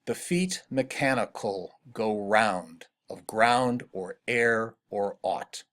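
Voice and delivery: slow metrical reading, leaning on each beat of "feet mechanical go round"; measured verse reading with the stressed syllables drawn out. Shown as a demonstration. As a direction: recite slowly so the beats in "ground or air or aught" stand out. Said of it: "see if you can hear this".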